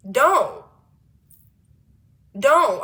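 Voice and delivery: loud, short command with falling end; firm refusal. Acted. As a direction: shut it down loudly in one word. Firmly refusing coworker's touching.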